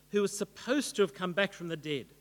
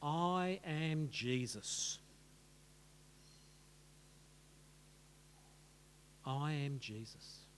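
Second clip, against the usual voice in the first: slow, low, quiet declaration; soft and weighty, each word given room; voice of Jesus; 6 semitones lower, 11 dB quieter, slower than usual. Acted. Reply from heaven to Saul, slow solemn divine voice. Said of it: "comes back from heaven".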